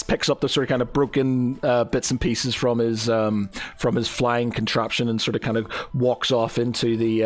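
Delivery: ordinary talk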